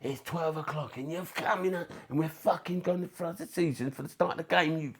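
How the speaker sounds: high mock yell of a furious sergeant major; a hint of a shouting drill sergeant; mock coach yelling. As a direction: tear into the late players. Coach yelling at drunk players.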